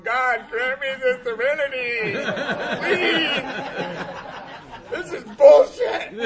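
high, loud, slow, laughing plea; laughing mock prayer. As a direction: cry out a desperate prayer through laughter. Praying in jail beside crack smoker.

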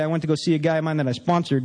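ordinary talk

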